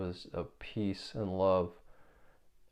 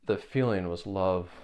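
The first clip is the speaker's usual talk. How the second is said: ordinary talk, nothing marked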